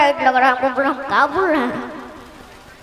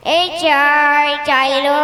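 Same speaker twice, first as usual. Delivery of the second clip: very slow, drawn-out street vendor cry; tea seller call; much slower than usual. Retold or imitated. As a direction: stretch the call like a station chai hawker. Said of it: "सुबह में जब station पे चाय बेचती है".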